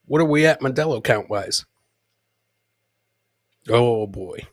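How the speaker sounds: usual speaking voice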